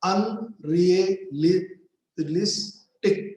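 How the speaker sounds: "Unrealistic" very slowly broken as un-rea-lis-tic; split into syllables. Shown as a demonstration. Teacher counting syllables in a long word, broken apart. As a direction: say "Unrealistic" in separate, drawn-out beats. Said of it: "four syllable".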